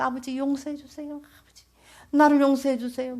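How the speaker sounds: high, loud plea with 나를 pressed; begging forgiveness. Retold or imitated. Penitent daughter begs God for forgiveness, high and loud. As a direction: cry out for mercy.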